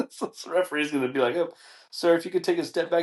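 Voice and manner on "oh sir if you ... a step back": high, polite official's request; courteous referee, pitched up; lightly voiced referee. Referee asking dad to step back, mock polite.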